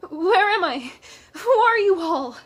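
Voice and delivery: loud, high panicked questions. A narrator's reading. Injured blind man waking among strangers, panicked shouting. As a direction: cry out in alarm, pitch jumping up. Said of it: "He was panicked".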